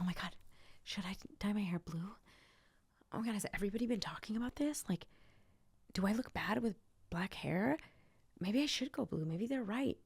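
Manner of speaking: soft, anxious questioning, mock panic; hushed self-doubt. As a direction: fret under your breath, imitating someone unsure. Acting out self-doubt after criticism.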